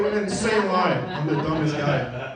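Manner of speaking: ordinary talk, nothing marked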